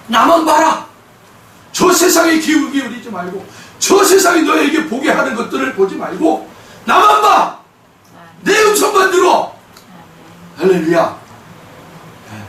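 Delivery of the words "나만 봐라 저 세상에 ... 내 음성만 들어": high, insistent divine command; urgent command. Acted. God calling his child to look only at him, urgent command. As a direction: call out firmly, pitched well up.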